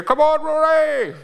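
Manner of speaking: high, loud mock fan shout; cheering for Rory from the crowd, loud and high. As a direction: yell it like a fan at the golf. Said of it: "how often are you gonna hear".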